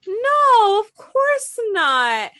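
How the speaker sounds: high, slow sing-song no of course not; high mock reassurance. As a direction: quote him in a high slow reassuring lilt. Boyfriend reassuring, quoted.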